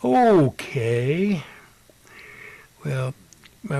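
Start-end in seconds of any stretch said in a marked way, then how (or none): 0.0-1.4 drawn-out, loud, high swooping okay; stretched okay, pitch swooping; taken-aback okay. Retold or imitated. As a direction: react as if that was more answer than expected. Taken aback by frantic yes answer.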